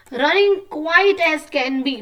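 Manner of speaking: very slow sing-song line; reciting a rhyme. Shown as a demonstration. Teacher reading the rhyme to kids, very slow. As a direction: draw out each word like a nursery rhyme. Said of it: "छोटी सी बोल लिखी है".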